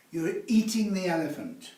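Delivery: normal talking voice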